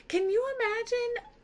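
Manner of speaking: a high, squeaky can-you-believe-it; high squeal of disgust at the poor dog; squealed disbelief. Real, not acted. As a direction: squeal it out in mock horror. Teacher reacts to disgusting dog scene.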